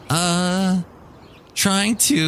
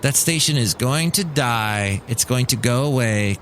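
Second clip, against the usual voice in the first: normal talking voice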